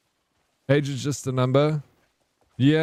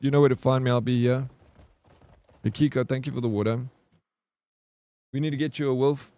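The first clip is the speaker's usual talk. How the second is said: ordinary talk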